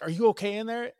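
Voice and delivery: high, loud worried call through a door. Retold or imitated. Wife calling to her daughter through the locked door, worried and loud.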